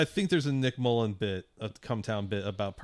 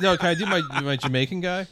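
eager pleading; 6 semitones higher, 5 dB louder, faster than usual. Pretend. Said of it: "just being like".

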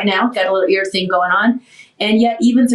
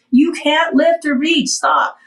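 higher, slower mock telling-off; imitated scolding; 7 semitones higher, slower than usual. Friend warning patient after surgery, high slow mock scolding. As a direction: lay down the rule as the friend did.

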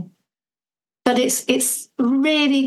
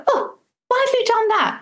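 much higher, exaggerated cry of a put-upon parent; mock exasperated parent; 12 semitones higher than usual. Distracted parent reacting to a toddler who bashed her. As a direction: play the distracted parent suddenly shocked.

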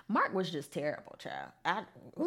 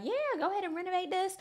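quick, higher, eager imitation of the group; eager go-ahead; 9 semitones higher, faster than usual. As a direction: rattle off the group's eager yes. Everybody's eager approval, retold.

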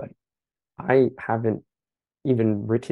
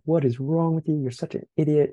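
retold self-scolding scream; 6 semitones higher than usual. Player berating himself over a missed warm-up ball, restrained retold scream. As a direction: imitate screaming at yourself, pitch up, volume held back. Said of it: "I was screaming at myself".

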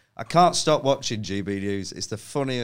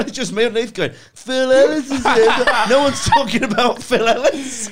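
much higher and louder, cracking up at himself; laughing while telling; 13 semitones higher, 10 dB louder than usual. Real, not acted. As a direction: tell it through helpless laughter. Retelling embarrassment, laughing.

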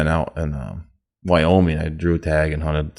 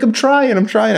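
strained reply; 4 dB louder than usual. Retold or imitated. Strained reply under pressure.

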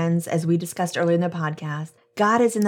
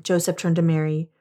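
ordinary talk, nothing marked

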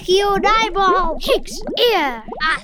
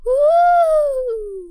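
playful spooky ghost woo; ghost impression; 7 semitones higher than usual. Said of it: "Check out my ghost impression".

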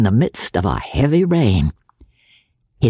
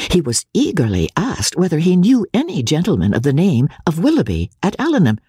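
ordinary delivery, nothing marked

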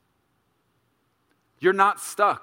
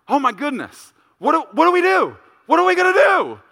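freaking out; 11 semitones higher, 8 dB louder, faster than usual. Retold or imitated. Dad re-enacting his own freak-out over his stuck daughter, rising panicked cry. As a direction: panic climbing into a high, loud wail. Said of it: "I probably sound worse than Riley".

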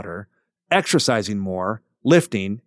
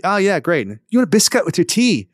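mock Irish host; 7 semitones higher, 3 dB louder than usual. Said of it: "mimicking Irish hosts offering tea".

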